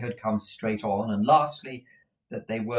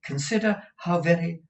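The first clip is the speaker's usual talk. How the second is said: urgent, higher-pitched plea; anxious interrupted objection; hasty protest; 8 semitones higher, 4 dB louder than usual. A narrator's reading. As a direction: protest quickly, breaking off. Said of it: "returned Kate hastily".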